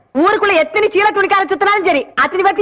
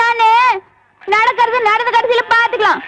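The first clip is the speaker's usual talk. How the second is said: ordinary talk, nothing marked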